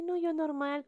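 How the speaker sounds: usual speaking voice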